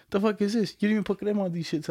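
high, incredulous dad yelling at a dirty car; dad's outraged voice. Retold or imitated. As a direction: squeal it up high like an offended father. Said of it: "my dad hopped in the whip one time and he's like".

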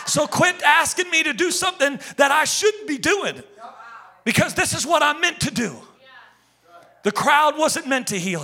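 loud, high, driving sermon; fiery preaching. Acted. Pastor rebukes the church from the pulpit, fiery preaching. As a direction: preach it hard to the congregation.

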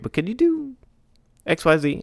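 high, sing-song client asking; mock client voice. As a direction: play the needy client sweetly.

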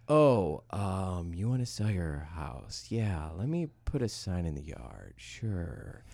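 low, soft, bored mock agent. Mimicking the sleepy local agents, low and bored. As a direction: mumble it like a lazy old realtor. Mocking dull old agents.

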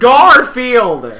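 high, very loud outburst; retold screaming. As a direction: yell the name out of nowhere. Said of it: "start screaming shit at".

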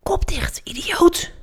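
high, sharp stage whisper; urgent whisper. A narrator's reading. Julius secretly shuts Felix up mid-fight, whispering. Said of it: "fluisterde Julius".

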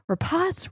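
Rapace said as "ra-PAHS", high and questioning; guessed pronunciation. Shown as a demonstration. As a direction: try "Rapace" to rhyme with "floss", unsure. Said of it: "I still don't know how to say".

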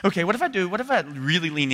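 high, loud hopeful question; daring hope. Retold or imitated. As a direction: leap toward faith. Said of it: "I'm so afraid to go".

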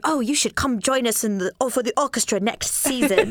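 put-on light British accent, a fond jovial take on Nick; a mild British-accented Nick, done with love; Nick impression. Inviting a violinist to join, in a light British accent. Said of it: "that's my Nick impression".

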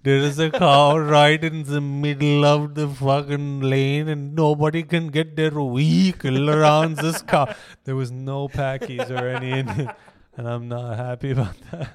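loud, slow hammed-up Indian accent rant; mock Indian accent. Comic bit to friend about a cow, loud mock Indian accent.

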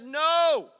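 slow, loud preached declaration; preaching. Real, not acted. Preacher proclaims a man's stand in adversity, slow and loud. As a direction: proclaim it with force.

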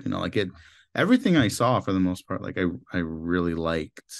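normal talking voice

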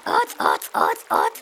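harsh, voiceless bird croak; croaking stork. A narrator's reading. Stork calling out before he speaks, harsh repeated croaks. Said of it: "krächzte der Otzenstorch".

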